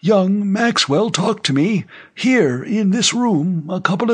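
put-on high-pitched old man's voice; old general, firm denial. Old general answering the rumor on screen, high and insistent. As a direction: deny it firmly in a high elderly voice.

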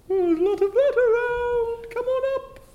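far away. Acted. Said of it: "He sounds really far away".

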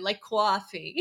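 "coffee" with a W glide after the C; W inside coffee. Shown as a demonstration. Host showing Tim's accent, "coffee" with a W in it. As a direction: say "coffee" as if it were "cwoffee". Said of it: "almost like there's a W in it".

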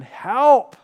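pleading cry to the Lord; desperate cry for help. Retold or imitated. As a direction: call out for help like someone drowning. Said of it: "cry out to the Lord".

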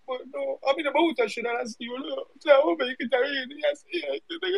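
high, tearful pleading; convincing fake crying. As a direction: break into a put-on weepy whine, pitch climbing. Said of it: "parece que estás llorando en serio".

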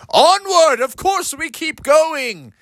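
high, hammed-up heroic father; bold father. Father Bear urges the family on, grand and hammed-up. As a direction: proclaim it grandly. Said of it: "And Father said".

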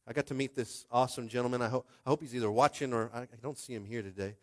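ordinary talk, nothing marked

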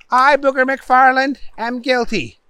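high prompt, softening at the end; upset judge prompting. A narrator's reading. Judge giving Booger the second option, high but restrained. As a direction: offer the other plea with strained patience. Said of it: "The judge was getting upset".

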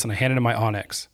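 normal talking voice

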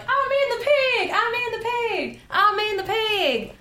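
high, drawn-out Southern practice line; high Southern character voice; Jamie Presley. Shown as a demonstration. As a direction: stretch it out in a high Southern twang. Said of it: "Jamie Presley's character for this one thing".

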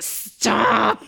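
long, stretched-out stop; playfully put-upon retort; exasperated protest. Retold or imitated. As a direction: draw the word out in mock annoyance. Annoyed retort when mannerisms pointed out.

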